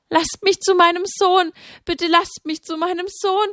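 high, desperate begging at the tombstone. A narrator's reading. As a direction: plead to be let back to her son.

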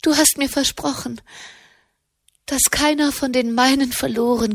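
high, urgent plea to God; pleading. A narrator's reading. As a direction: press God to keep his word. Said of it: "flehte sie". (hohe, flehende Anklage an Gott)

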